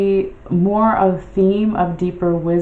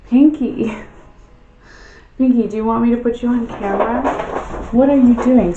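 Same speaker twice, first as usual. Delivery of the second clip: pet talk; 5 semitones higher, 3 dB louder, faster than usual. Real, not acted. Calling noisy cat again.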